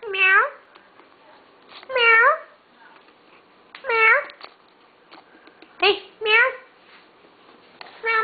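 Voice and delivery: high playful cat meows; meowing at the cat. Pretend. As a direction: meow up high to get the kitty's attention.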